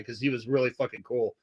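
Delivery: ordinary talk, nothing marked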